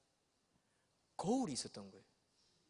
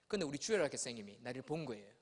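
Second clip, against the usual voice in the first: ordinary talk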